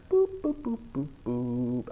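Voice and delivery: toy-like boops, drawn out at the end; playful boops. Real, not acted. Playful noises while clicking.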